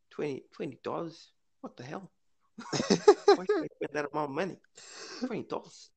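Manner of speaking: high-pitched, put-on New Zealand accent. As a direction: slip into an indignant, jokey New Zealand voice. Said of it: "I went to that accent".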